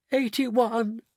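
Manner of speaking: high-pitched miserable wail. A narrator's reading. Mrs Bucket answering about her father, wailing. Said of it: "wailed Missus Bucket".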